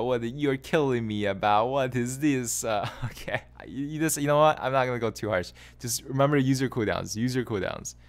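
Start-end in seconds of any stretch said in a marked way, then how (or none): none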